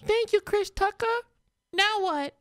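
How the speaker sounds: put-on high-pitched girl voice. Voicing girl character.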